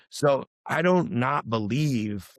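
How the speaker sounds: normal talking voice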